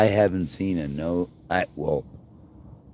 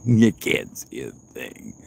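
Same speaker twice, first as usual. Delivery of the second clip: ordinary delivery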